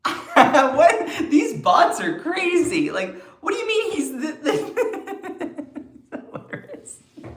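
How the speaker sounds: high-pitched incredulous laughing fit. Real, not acted. Streamer cracking up over bot comments, laughing. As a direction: lose it laughing, barely getting words out.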